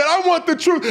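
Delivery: high, loud yelled demand; courtroom interrogator. Retold or imitated. Questioner demanding the truth from Colonel Jessup, heated shout. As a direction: shout it as an ultimatum. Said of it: "like in A Few Good Men".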